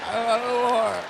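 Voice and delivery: a touch of whiny sobbing denial; mock crying. Comedian to his friend, mild mock tearful whine. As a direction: whimper it like a scolded kid. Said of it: "I'm sad".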